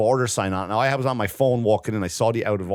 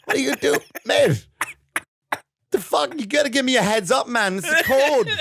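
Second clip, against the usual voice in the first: much higher, laughing through the complaint; high laughing outrage; 13 semitones higher than usual. Retold or imitated. Retelling the bathroom clash to his friend, high laughing outrage. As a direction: scold him while cracking up. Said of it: "I'm like".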